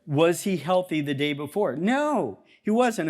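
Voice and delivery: ordinary delivery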